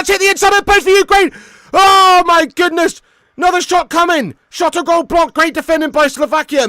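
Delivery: high, loud, breathless-paced play-by-play; excited match shouting. Real, not acted. Football streamer calling a near goal for Ukraine, excited shouting. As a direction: yell the chance as it happens.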